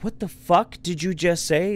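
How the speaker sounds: retelling an affronted comeback; incredulous. Retold or imitated. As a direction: draw it out as an incredulous challenge. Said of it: "this look of incredulity in her face".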